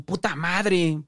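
high, drawn-out angry yell; angry shout. A narrator's reading. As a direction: shout it to be heard through the house. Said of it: "Volvió a gritar".

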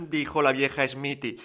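ordinary delivery, nothing marked

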